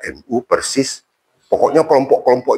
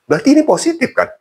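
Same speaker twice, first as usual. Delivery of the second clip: playful rhetorical question; faster than usual. Real, not acted. Jokey savings conclusion.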